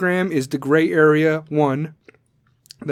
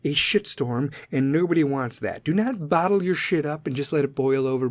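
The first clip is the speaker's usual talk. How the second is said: ordinary delivery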